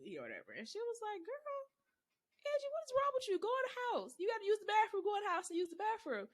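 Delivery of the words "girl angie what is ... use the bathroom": high, scolding grandma voice; high-pitched grandma telling a child off. Retold or imitated. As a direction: mimic grandma fussing at the kid.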